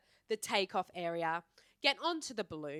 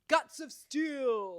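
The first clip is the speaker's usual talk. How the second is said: loud, drawn-out triumphant cry; mock-heroic boast; 5 semitones higher, 7 dB louder, much slower than usual. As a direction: stretch it out like a battle cry. Playful boast about strong stomach.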